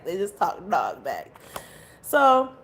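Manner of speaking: normal talking voice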